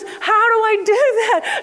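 put-on high, laughing child question; amused retelling of a confused girl; puzzled girl, laughing. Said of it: "She goes".

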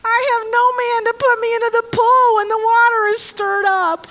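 lightly put-on whiny complainer; a hint of a sick man's self-pity; whiny excuse voice. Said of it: "I think he said it in that tone of voice".

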